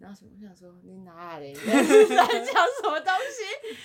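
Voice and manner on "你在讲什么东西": slow, much higher and louder, laughing disbelief; what on earth are you saying, laughing; baffled retort. Retold or imitated. Niece to uncles talking wedding, laughing disbelief.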